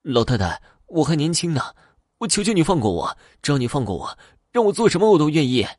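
high, desperate pleading; near-tearful begging. A narrator's reading. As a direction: beg for mercy, pitch climbing, rushing the last words. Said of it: "差点哭了起来".

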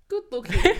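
boss's words, laughed out in disbelief; laughing. Real, not acted. Retelling boss's awkward words.

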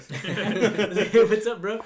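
loud, high, laughing hello; mock greeting. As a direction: greet him like an old buddy, loud. Hey, there's Hector.

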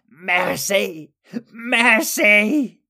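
high, held wail of begging creatures; crying for mercy. A narrator's reading. Routed Skurgs begging the wizards for mercy, high desperate cry. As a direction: plead in a high, drawn-out cry. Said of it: "the Skurgs cried".

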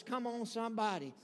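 usual speaking voice

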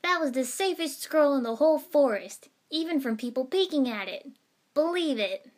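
high, loud boastful defence; indignant, animated declaration; loud insistence. A narrator's reading. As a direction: insist on it emphatically, at full voice. Boy loudly defends himself after yelp.

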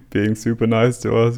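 normal talking voice

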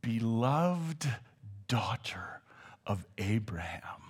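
soft, hushed whisper; whispered tenderness. Retold or imitated. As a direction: drop to a whisper for the beloved daughter. Tender aside inside Jesus' rebuke.

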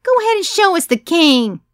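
high, loud tipsy scream; rowdy partygoer yelling out. A narrator's reading. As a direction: shriek from the back of the room. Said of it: "someone screamed".

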